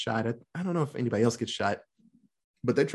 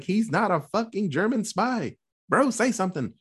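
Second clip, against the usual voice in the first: higher, exasperated protest; mock protest; 7 semitones higher than usual. Tommy arguing with the soldiers, higher exasperated protest. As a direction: push back hard for your friend. Tommy argues Gibson isn't spy.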